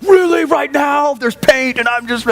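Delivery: high, shouted mock disbelief at the mess; exasperated disbelief. Dad sees paint spilled on the bricks, exasperated shout. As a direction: throw up your hands, hammed-up outrage.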